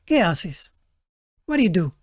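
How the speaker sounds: a dismissive, rude ¿Qué haces?. Shown as a demonstration. Teacher contrasting with the friendly one, a rude ¿Qué haces?.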